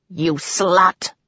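mild snarl of accusation. A narrator's reading. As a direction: spit the insult with contained anger. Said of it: "he snarled".

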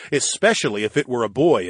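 ordinary delivery

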